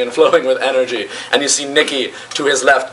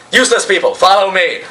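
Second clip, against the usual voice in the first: high, commanding call; acted captain's order, pitched up; barked summons; 6 semitones higher than usual. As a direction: round up your underlings imperiously. Captain summons his underlings.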